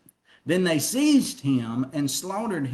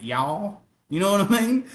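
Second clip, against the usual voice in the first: Southern accent. Shown as a demonstration. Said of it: "we have this Southern accent".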